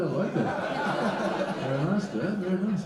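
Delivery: high sarcastic praise through a laugh; mock praise. Son teasing his dad, high mock approval. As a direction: tease dad with fake approval.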